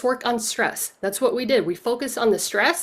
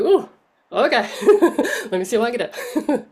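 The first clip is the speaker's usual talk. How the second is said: laughing reply; 3 dB louder, faster than usual. Retold or imitated. Coach laughing while answering the client.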